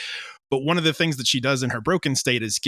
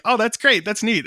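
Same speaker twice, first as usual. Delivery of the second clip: higher, louder bright mock enthusiasm; mock cheerful; 10 semitones higher, 5 dB louder than usual. Writer mocking her past self, chirpy and upbeat. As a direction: oversell the cheer of the writing stage. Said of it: "it's easy in the writing stage to be like".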